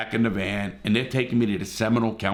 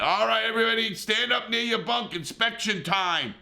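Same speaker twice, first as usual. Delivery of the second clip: high, bossy mock kid's voice, much higher; mocking the young boss; 11 semitones higher than usual. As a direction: ape a cocky young supervisor calling inspection, pitched way up. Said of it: "gives everybody a, like".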